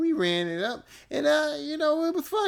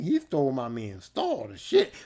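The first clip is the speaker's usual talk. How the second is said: normal talking voice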